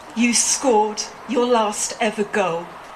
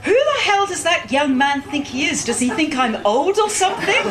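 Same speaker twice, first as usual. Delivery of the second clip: mock-indignant, higher-pitched outburst; retold anger; 6 semitones higher than usual. Father complaining to daughter about the young man, mock-angry. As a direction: act out an offended father's indignation. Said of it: "looks at me very angrily".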